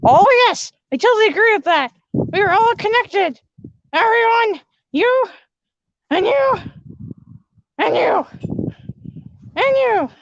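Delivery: high, loud and eager princess; princess yelling. Acted. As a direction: shout it to each of them in turn. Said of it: "you can stop screaming too".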